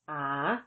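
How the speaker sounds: ah dipping then rising, Thai rising tone; ah slow and rising. Shown as a demonstration. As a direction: let ah rise at the end. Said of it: "the tone is rising, as in".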